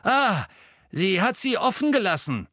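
laut, hoch und triumphierend; aufgeregter Ausruf. A narrator's reading. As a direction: begeistert losrufen, Stimme weit oben. Filch jubelt vor der Tür. (freudig erregt)

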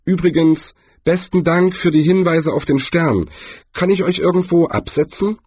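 high, lively little trader; shopkeeper character voice. A narrator's reading. Voices the shopkeeper offering help.

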